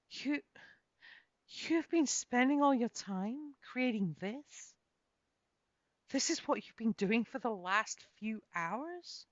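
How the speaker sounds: high, slow, wonder-struck questions; stunned awe, drawn out slowly; aghast. A narrator's reading. Kate marvelling at Leifildur's hidden creation, aghast. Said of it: "I asked, aghast".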